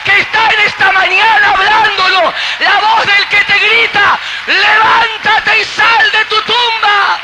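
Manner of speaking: high, loud roaring preaching; preaching at full force. Acted. Pastor calling the dead to rise, shouting. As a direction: shout the resurrection call over the room.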